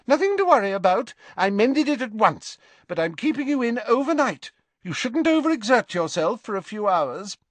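high, quick bustling nurse; brisk matron reassuring briskly. Acted. Matron figure in story.

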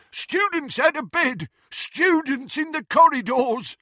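high, loud alarmed bellow; shouting. A narrator's reading. Aged caretaker hobbling into view to report students, shouting. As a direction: raise the alarm at full pitch.

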